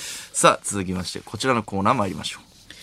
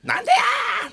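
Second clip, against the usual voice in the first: high yelling tsukkomi, pitched way up; imitated shout; slower than usual. Imitating Oda's variety-show yelling, a high shout. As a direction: belt it like Oda's retort. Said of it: "死ぬほど怒鳴ってくれてたけどな".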